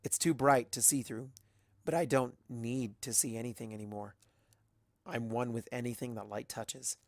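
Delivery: usual speaking voice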